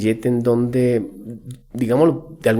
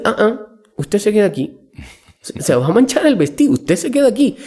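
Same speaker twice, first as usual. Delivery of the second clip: mother scolding; 5 semitones higher than usual. Retold or imitated. Mother scolding girl at party.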